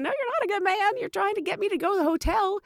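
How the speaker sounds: mock indignant reply; indignant retort. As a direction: call out his lie. Her inner retort.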